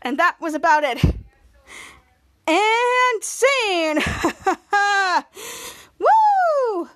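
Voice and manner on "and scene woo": high, loud cheer and laugh; excited finish. Real, not acted. Kid finishing recap, excited whoop. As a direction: wrap it up with a whoop.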